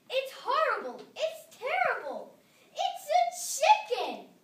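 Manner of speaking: very high, loud, slow outraged bellow; rising to a shrieking peak on chicken. A narrator's reading. Missus McSnoot bellowing at the chicken hat, very high and loud. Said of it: "bellowed Missus McSnoot".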